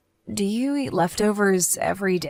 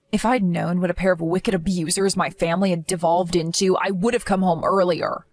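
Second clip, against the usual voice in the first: ordinary delivery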